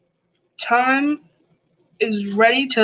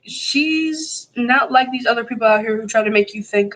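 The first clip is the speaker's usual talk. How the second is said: usual speaking voice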